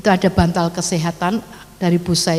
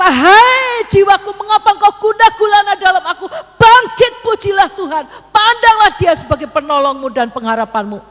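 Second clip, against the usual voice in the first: rousing his own soul; 10 semitones higher, 6 dB louder than usual. Acted. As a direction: cry out to rouse your own soul. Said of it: "Ngomong sendiri kepada jiwanya". (seruan lantang membangkitkan jiwa sendiri)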